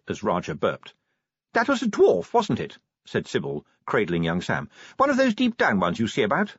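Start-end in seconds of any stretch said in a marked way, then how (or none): 1.5-2.8 high-pitched character voice; Sybil's voice. A narrator's reading. Sybil voiced in narrated story. 5.0-6.5 high-pitched character voice; Sybil's voice. A narrator's reading. Sybil voiced in narrated story.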